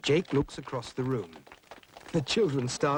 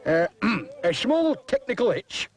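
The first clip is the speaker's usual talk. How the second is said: put-on high, sheepish little-boy voice with a throat clear; embarrassed boy; 7 semitones higher than usual. Boy covering a stage mishap, sheepish and high. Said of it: "says Harry".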